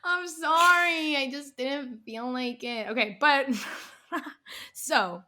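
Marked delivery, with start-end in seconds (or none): 0.0-2.9 higher, slow, playful apology; mock apology. Apologizing to the guy on air, sheepish slow mock.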